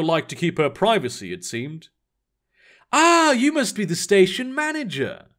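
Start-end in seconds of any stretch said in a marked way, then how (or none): 2.9-5.3 put-on a light posh English accent, pitched up high; refined English accent. A narrator's reading. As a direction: greet him with a refined movie-style English accent. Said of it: "She spoke with a refined English accent".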